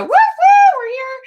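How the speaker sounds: high, loud whoops; game-show contestant cheer. Shown as a demonstration. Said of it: "like Price is Right".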